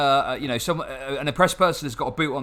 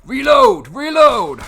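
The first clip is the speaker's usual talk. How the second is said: loud high shout at the game; shouting reload; 9 semitones higher, 9 dB louder, much slower than usual. Real, not acted. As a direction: yell it out in frustration.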